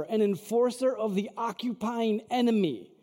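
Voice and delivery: usual speaking voice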